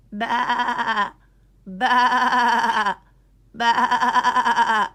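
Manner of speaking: loud, high sheep bleats; big silly baa-ing; bleating sheep. Acted.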